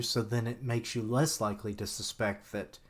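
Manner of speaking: ordinary talk, nothing marked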